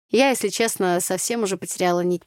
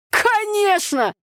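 very high, slow scornful snort; snorting scoff; 14 semitones higher, 4 dB louder, much slower than usual. Acted. Tanya snorting at her friend's remark. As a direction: scoff at the very idea. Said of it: "фыркнула Таня".

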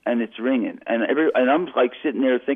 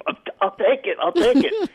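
laughing relief; 10 semitones higher than usual. Retold or imitated.

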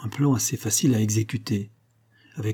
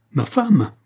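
higher, louder cry of a husband; shocked cry; 6 semitones higher, 5 dB louder than usual. A narrator's reading. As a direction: cry out in shock for your wife. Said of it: "cria monsieur Dunois". (cri bouleversé)

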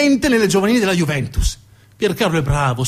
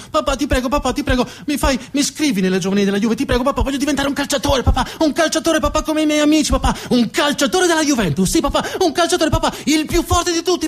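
put-on high boy's voice, begging faster and faster; pleading child building; 11 semitones higher than usual. Boy begging his dad to sign him up for Juventus, high and breathless. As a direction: nag relentlessly like an excited little kid, then stretch the last words.

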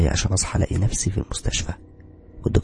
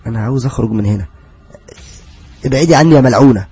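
frightened shout; 4 semitones higher, 8 dB louder than usual. Retold or imitated. Narrator yelling at ghost.

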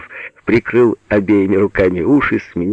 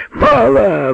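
high drawn-out bellow; sudden roar; 4 dB louder than usual. A narrator's reading. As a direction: roar out for more. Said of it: "взревел он вдруг". (внезапный рёв)

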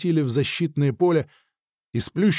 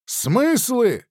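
slow, high, declaiming shout; shouted postulate; 7 semitones higher, 3 dB louder, much slower than usual. Acted. Nikita shouts the first postulate to the thinker, slow proclaiming. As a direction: proclaim each creed line slowly. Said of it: "выкрикнул я первый постулат".

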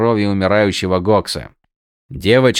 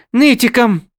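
huffing retort; 14 semitones higher, 5 dB louder than usual. Acted. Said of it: "пропыхтела крадунья".

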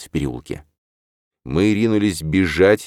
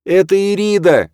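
high, loud desperate call; shouting; 7 dB louder than usual. A narrator's reading. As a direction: call through the door. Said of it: "крикнула девушка".

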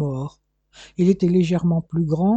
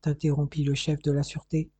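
plain reading voice

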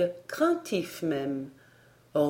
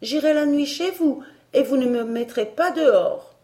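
high, louder spiteful defiance; defiant girl; 7 semitones higher, 5 dB louder than usual. A narrator's reading. Elsie defies her governess, high, loud and vexed. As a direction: throw down a childish challenge.

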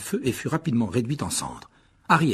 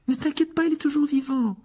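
put-on much higher, reassuring girl's voice; reassuring Hermione. A narrator's reading. Hermione reassures Ron about his owl, high and soothing. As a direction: soothe him, she's got this. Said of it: "le rassura Hermione".

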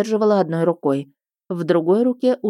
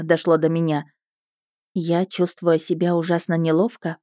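plain reading voice

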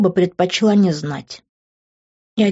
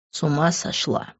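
low-volume, restrained angry hiss; 3 semitones lower, 7 dB quieter than usual. A narrator's reading. Man grabbing her by the shoulders, softly hissed disbelief. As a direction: hiss it under your breath, much softer. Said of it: "прошипел он".

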